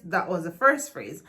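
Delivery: ordinary talk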